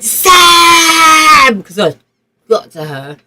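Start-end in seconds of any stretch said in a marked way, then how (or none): 0.0-1.6 loud, high drawn-out scream of a name; retold scream. Girl screams for her injured friend, full-voiced retold scream. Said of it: "I started screaming".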